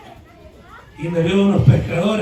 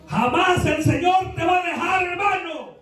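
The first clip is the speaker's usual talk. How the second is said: much higher, shouted promise; fervent shout; 10 semitones higher than usual. Acted. Preacher assuring his brother, fervent shouted promise. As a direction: proclaim it with full force.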